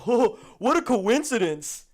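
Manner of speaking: hammed-up "Oh, what a coincidence"; mocking roommate voice. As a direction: play the roommate as a smug twit. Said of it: "And he's like".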